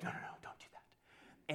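put-on whispered, pleading little girl; pleading toddler. Pastor voicing his niece begging to keep her blankie, whispered. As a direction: beg softly like a scared two-year-old. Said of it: "And Shiloh's like".

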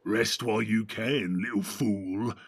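low, slow sneering orc. A narrator's reading. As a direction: growl it like a cruel orc. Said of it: "he said then to Pippin".

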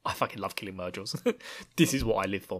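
ordinary talk

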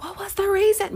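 squeaky exasperated what-was-the-point; whiny complaint. Retold or imitated. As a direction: play the griping Israelites. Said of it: "the children of Israel's like".

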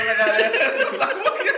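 high laughing voice. Real, not acted. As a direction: laugh through the words. Laughing at the joke.

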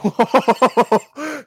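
loud, high burst of laughter; laughing. Real, not acted. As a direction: crack up at the joke. Laughs at the joke.

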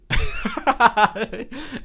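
rising giggling laugh; high laugh. Real, not acted. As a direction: crack up at yourself. Laughs at "buzzy.

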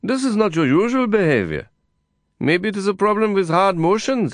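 a hint of a fussing old neighbor; nosy neighbor. Neighbor voiced in story.

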